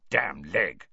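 ordinary delivery

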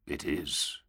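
deep, slow, bleak intoning; low grim drawl. A narrator's reading. Grimaldus voiced.

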